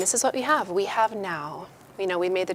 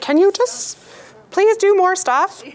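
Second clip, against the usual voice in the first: impatient plea; 10 semitones higher, 6 dB louder than usual. Retold or imitated. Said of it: "I was like".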